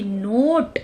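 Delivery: नोट with a slow, long o; stretched o. Shown as a demonstration. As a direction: draw the o in नोट out slowly. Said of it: "ओ की आवाज़ यहाँ पे loud हो गई". (नोट में ओ लंबा खींचकर)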